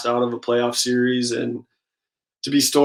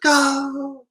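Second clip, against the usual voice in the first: a much higher, sudden startled yelp; imitating brother freaking out; 14 semitones higher than usual. Brother opening a card pack, imitated freaking-out cry. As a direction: mimic a burst of shock at the pack. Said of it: "he starts freaking out".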